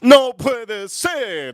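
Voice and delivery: high, loud, very drawn-out exclamation; put-on incredulous dad voice; friend's father. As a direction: stretch it out in high disbelief, like the dad. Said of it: "and the father goes".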